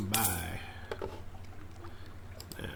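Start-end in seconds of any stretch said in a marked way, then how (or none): none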